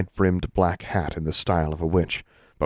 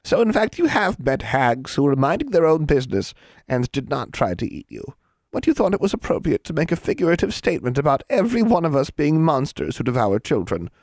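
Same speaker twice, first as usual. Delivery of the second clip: high-pitched cross-examination; triumphant, officious hag; 9 semitones higher than usual. A narrator's reading. Hag cross-examines the girl on her claim, affronted. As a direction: press the point home, affronted.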